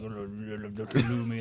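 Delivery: posh put-on gushing; pretentious. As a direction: say it like a food snob. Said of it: "it's very pretentious of me to be like".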